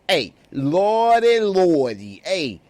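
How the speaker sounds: loud, drawn-out New York exclamation; New York accent. Acted. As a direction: holler it like a brash New Yorker. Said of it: "She from the N Y C".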